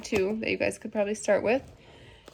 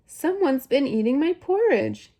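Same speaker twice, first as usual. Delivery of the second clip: higher, lilting mother bear; affronted Mama Bear, pitched up without shouting; moderate-sized storybook bear voice, raised in pitch; medium-sized Mama Bear voice; 5 semitones higher, 5 dB louder than usual. A narrator's reading. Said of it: "in her medium-sized voice".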